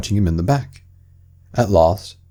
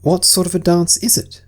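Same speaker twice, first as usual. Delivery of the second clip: put-on high child voice asking; Alice, curious and polite; curious young girl; 10 semitones higher than usual. Alice asking the Mock Turtle, curious.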